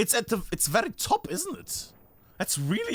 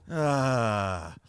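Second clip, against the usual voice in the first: low groan trailing downward; long low groan; 8 semitones lower than usual. Real, not acted. Gamer fed up with his ping, long low groan. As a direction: groan it out, slowly sinking.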